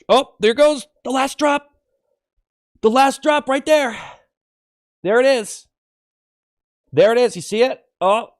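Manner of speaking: high, loud play-by-play of the final drop; hammed-up urgent announcement; mock alarm. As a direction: call it out like a big moment. Acting out last drop of gas.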